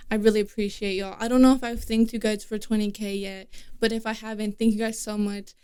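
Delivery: usual speaking voice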